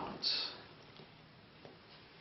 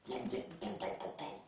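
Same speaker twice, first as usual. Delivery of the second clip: soft, breathless glub sounds; mouthed fish noise; 4 semitones higher, 9 dB quieter than usual. Acted. Soldier in the tank talks like a fish, soft mouthed glubs.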